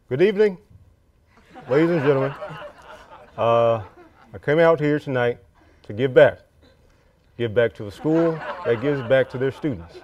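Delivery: lightly voiced presidential speech impression; measured statesman's address with deliberate pauses; Obama. Student impersonating a president addressing the audience, lightly done presidential voice. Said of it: "really good Obama impersonation".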